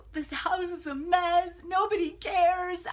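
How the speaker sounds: high, loud, slow, wailing self-pity; mock weepy whine. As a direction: wail it like you're about to burst into tears. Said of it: "I was gonna cry a river".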